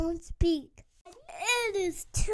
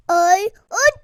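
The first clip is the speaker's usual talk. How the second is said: sneeze building; 12 dB louder than usual. Acted.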